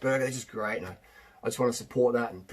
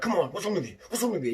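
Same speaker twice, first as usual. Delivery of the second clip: higher-pitched mock exasperation; hammed-up aggressive pleading; 4 semitones higher, faster than usual. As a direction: badger an unwilling pet, play-acted. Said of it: "and you're aggressive".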